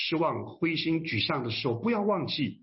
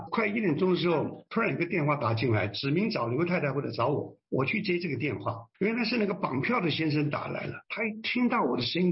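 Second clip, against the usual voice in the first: ordinary talk, nothing marked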